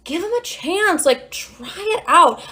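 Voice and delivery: high, slow, coaxing therapist voice; imitated therapist. Therapist reverse-psychologying her client, high coaxing imitation. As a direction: draw it out high, casually egging them on. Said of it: "her being like".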